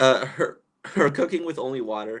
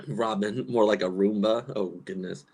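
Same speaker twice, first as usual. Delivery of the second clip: normal talking voice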